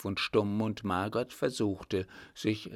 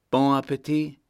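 sarcastic interruption; 4 semitones higher, 7 dB louder than usual. A narrator's reading. Kaiser interrupts the kissing couple.

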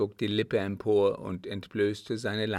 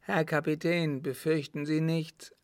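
Adeline calling out; 7 semitones higher than usual. A narrator's reading. Adeline reassures the captain from afar, lightly calling out. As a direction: call across in a higher voice. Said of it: "rief ihm Adeline entgegen".

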